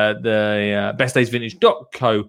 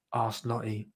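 plain reading voice

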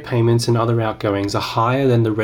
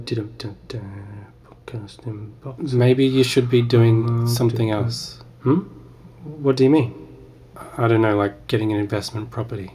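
ordinary delivery

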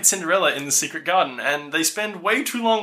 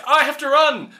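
urgent princess; 9 semitones higher, 4 dB louder than usual. Retold or imitated. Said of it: "She's like".

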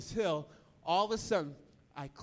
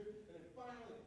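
ordinary delivery

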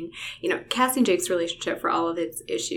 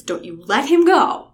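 urgent cry; 7 semitones higher, 11 dB louder than usual. A narrator's reading. Cassie urging, quote repeated louder.

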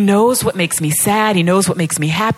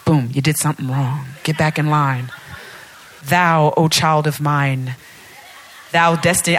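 low, solemn mock-Almighty voice; stern God on throne; 5 semitones lower than usual. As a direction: intone it low like a distant, pompous deity. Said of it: "he doesn't talk like that".

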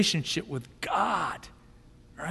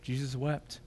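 Jesus wept repeated much softer, almost to oneself; hushed echo; 7 semitones lower, 13 dB quieter than usual. Shown as a demonstration. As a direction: let it fall away in a hush. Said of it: "Verse thirty-five says".

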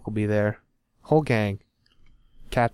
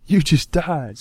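mock dismay; 6 semitones higher, slower than usual. Mock grief at his toy.

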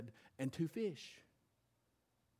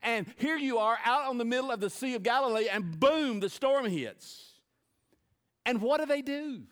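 loud, high preacher build; preaching; 10 semitones higher, 10 dB louder than usual. Acted. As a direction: preach it out, full force. Preaching at full force.

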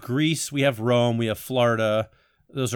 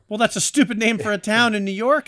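mock indignant; 8 semitones higher than usual. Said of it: "And I was like".

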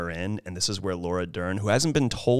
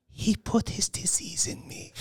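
whispered quote; 11 semitones higher, 5 dB quieter than usual. Retold or imitated. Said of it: "she goes".

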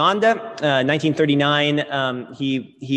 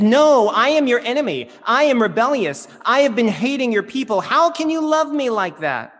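higher and louder, pleading against being loved; pleading protest; 8 semitones higher, 7 dB louder than usual. Retold or imitated. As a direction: cry out as the unworthy enemy. Festo protesting to Jesus.

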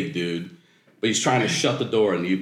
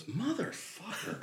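softer, under the breath curse; muttered to himself; 10 semitones higher, 14 dB quieter, slower than usual. Retold or imitated. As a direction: grumble it to nobody after hanging up. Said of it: "he goes to himself".

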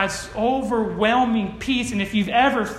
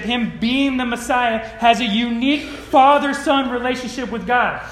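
ordinary talk, nothing marked

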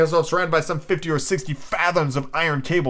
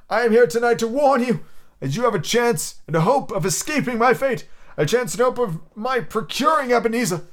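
ghost voice; 10 semitones higher than usual. Acted. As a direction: intone it like a chained spirit. Ghost warns.

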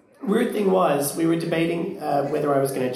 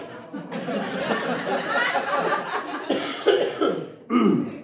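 coughing fit; 6 semitones higher than usual. Real, not acted. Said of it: "I sound like Kathleen Turner".

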